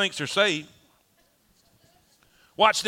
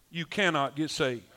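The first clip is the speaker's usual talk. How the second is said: usual speaking voice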